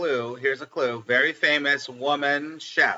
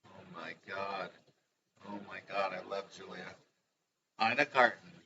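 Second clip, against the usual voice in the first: normal talking voice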